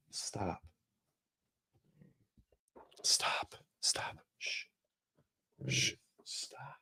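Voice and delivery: urgent whisper to quiet the dog; whispered hush. Real, not acted. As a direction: hiss stop under the breath. Hushing the barking dog.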